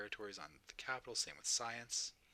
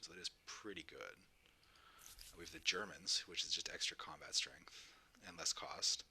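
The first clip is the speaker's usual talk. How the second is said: ordinary talk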